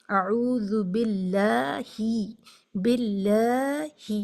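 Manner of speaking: باللہ with the lam clearly drawn out, slow; stretched lam. Shown as a demonstration. Teacher reciting slowly with a stretched lam. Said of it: "لام کو ضرور کھینچیں".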